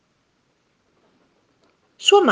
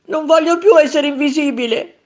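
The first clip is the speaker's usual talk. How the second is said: put-on high, louder plaintive child voice; heartbroken lament; 8 semitones higher, 6 dB louder than usual. A narrator's reading. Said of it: "si lamentava Tonino col cuore in pezzi". (lamento accorato)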